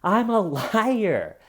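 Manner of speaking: hammed-up frantic confession. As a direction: plead it in mock terror. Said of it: "frantically going".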